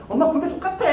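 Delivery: mock whining soldier; whining to mom. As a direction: whine to mom like a sulky son. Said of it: "막 이러는 거야".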